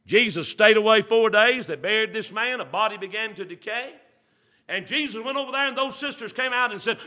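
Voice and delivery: high, loud preacher's call; climbing revival preaching; preaching at full force. Acted. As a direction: build to a ringing cry.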